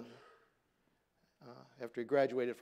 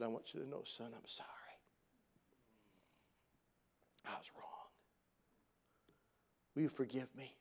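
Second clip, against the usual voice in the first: near-whispered, soft apology; the father's broken, softly whispered sorry; hushed apology; 3 semitones lower, 11 dB quieter than usual. Retold or imitated. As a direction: voice sinking almost to a whisper. Dad's tearful apology quoted.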